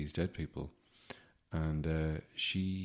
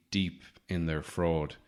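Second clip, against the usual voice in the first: normal talking voice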